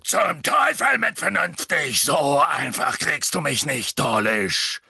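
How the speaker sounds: slow, loud, defiant bellow; furious drawn-out roar; roaring Hagrid. A narrator's reading. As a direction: roar it out like an enraged giant. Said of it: "Hagrid brüllte".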